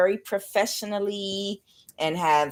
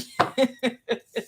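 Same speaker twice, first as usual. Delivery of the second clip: giddy laugh; 7 semitones higher than usual. Real, not acted. Laughing at her own reaction.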